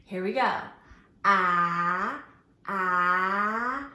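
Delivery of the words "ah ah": "Ah" as a short open a sound, stretched; letter A sound. Shown as a demonstration. Teacher sounds out the letter A for the kids, stretched "ah". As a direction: sound out "ah" before the word. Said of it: "put our letter A sound at the beginning".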